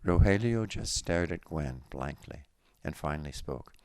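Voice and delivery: plain reading voice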